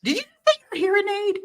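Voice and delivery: high, fast disbelief; can't believe what she heard; surprised. Real, not acted.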